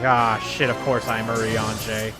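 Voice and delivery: ordinary talk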